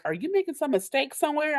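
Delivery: high, quick mimicked challenge; doubting kids. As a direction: imitate children questioning the result in disbelief. Kids challenging her announced results.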